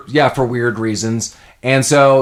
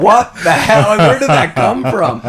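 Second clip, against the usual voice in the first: higher, laughing what-the-hell; retold reaction, laughing and a bit louder; laughing disbelief; 9 semitones higher, 4 dB louder than usual. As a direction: crack up in mock shock. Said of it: "I was like".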